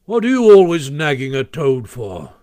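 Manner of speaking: loud, testy challenge; peevish. A narrator's reading. Badger scolds Rat for nagging Toad, peevish. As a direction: round on him irritably. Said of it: "inquired the Badger rather peevishly".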